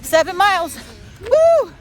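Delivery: triumphant high whoop at the finish; excited cheer. Real, not acted. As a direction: celebrate hitting seven miles. Finishing seven-mile run.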